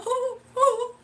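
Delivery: high, loud hammed-up sob; mock sobbing. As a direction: wail it like a comic crybaby. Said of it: "And I'll just, I'll just cry".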